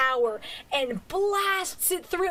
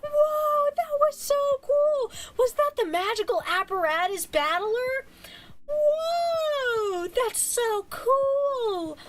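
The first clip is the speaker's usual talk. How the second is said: amazed cheering; 11 semitones higher, slower than usual. Retold or imitated. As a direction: squeal it like an awed kid in the crowd. Cheering crowd sees Iruma.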